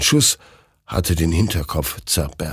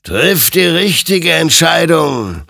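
higher, louder, slow admonition; warning; 10 semitones higher, 7 dB louder than usual. A narrator's reading. Rider warns Hektor with the gun, slow and grave. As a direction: warn him gravely, drawing it out. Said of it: "ermahnte ihn sein Gegenüber".

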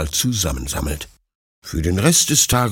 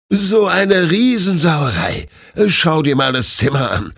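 scolding; 8 semitones higher, 4 dB louder than usual. A narrator's reading. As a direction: scold the puppy for the mess. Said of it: "schimpft Detlef". (schimpfend, langsam und lauter)

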